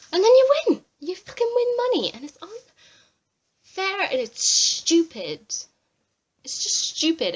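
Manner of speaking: real furious rant at Big Brother; furious shout. Real, not acted. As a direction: explode with outrage at the unfairness. Ranting about unfair win.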